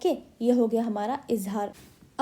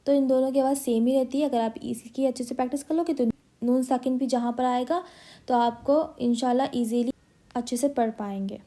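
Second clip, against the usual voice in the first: normal talking voice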